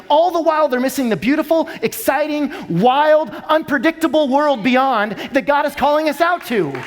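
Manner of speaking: fired-up sermon climax; preaching at full force. Real, not acted. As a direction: swell up to a shout on the call. Preaching climax builds to shout.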